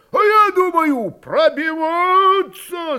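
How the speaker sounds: high, loud yell with drawn-out ending; shouted insistence. A narrator's reading. Cossack insists on fighting through, shouting. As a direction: stretch the last word as a rallying cry. Crowd member shouts a counter-proposal.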